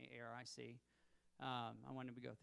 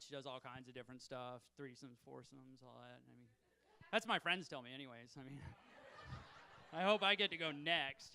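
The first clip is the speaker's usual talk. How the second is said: ordinary delivery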